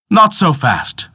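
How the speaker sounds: high, loud defiant cry. Acted. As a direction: stop him in his tracks. Peter produces the amulet.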